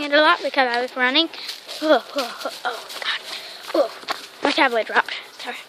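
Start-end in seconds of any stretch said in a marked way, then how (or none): none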